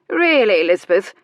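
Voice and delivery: loud, scandalized reproof; shocked, disapproving rebuke; reproving. A narrator's reading.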